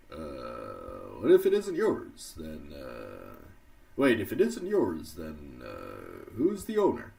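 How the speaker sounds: a hint of Obama; Obama impression. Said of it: "I'll do Obama".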